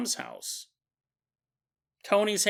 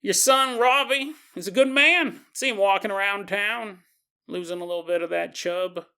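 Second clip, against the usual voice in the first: put-on higher, louder country sheriff accent; sheriff's accent; 4 semitones higher, 3 dB louder than usual. Sheriff complimenting a mother's son, put-on country accent. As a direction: praise him in a folksy lawman accent. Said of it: "I don't know why I gave him this accent".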